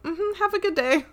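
very high customer-service singsong; fake-polite send-off. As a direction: chirp it sweetly, imitating forced politeness.

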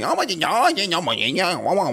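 sing-song mock-Chinese babble, starting high; made-up Chinese. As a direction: babble back in fake Chinese to tease. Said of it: "like, my made up Chinese".

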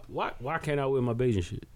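ordinary talk, nothing marked

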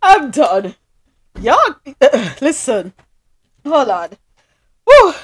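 breathless after laughing hard; gasping between words after laughter; out of breath from laughing. Real, not acted. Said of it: "I'm trying to catch my breath".

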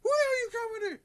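loud, high-pitched mock yell; mock angry girl. Retelling a prank video, mock angry girl yelling. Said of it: "And then the girl's like".

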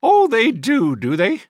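very high, loud disdainful retort; sniffy. A narrator's reading. Sister doubting the boys' claim, sniffy. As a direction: sniff it like a fussy spinster. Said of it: "sniffed the woman".

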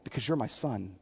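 very soft, low answer to his son; acted hushed fatherly reassurance; fatherly whispering. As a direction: drop right down, as if into his ear. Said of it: "why am I whispering into".

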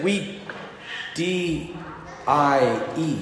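quiet, halting spelling; imitating a hesitant kid, hushed; reluctant child. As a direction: spell it out softly, letter by letter, afraid to say it.

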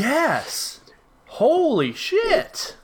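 high, drawn-out exclamation; real amazement. Real, not acted. Friend recalling the parking lot video, amazed. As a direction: stretch it out high in amazed recognition.

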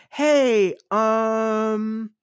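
high, drawn-out timid voice, mock passive-aggressive; meek Voldemort. Voldemort timidly checking in with Snape, meek high impression. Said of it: "like, really meekly doing the".

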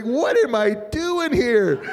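slow, high comic disbelief; bewildered outsider. Retold or imitated. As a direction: wail it high as if out of place. Acting out own bewilderment at game.

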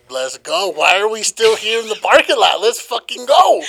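high, loud hammed-up hurry; eager mock shout. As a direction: push her to leave now, playfully. Pizza makes him eager.

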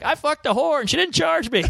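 high, bragging crow; triumphant boast. Retold or imitated. Teen boasting to his friends on the phone: high bragging crow. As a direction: crow it like a proud teenager.

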